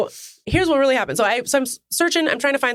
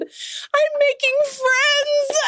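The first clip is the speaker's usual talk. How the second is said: much higher, very slow sing-song; giddy drawn-out delight; much slower than usual. Retold or imitated. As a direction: stretch it out like a proud child. Retold self, drawn out.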